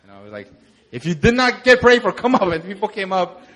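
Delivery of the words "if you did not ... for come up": raised, higher call to come up. Real, not acted. Pastor calls the crowd to come forward, loud and raised. Calling people forward to pray.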